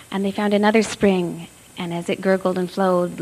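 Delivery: plain reading voice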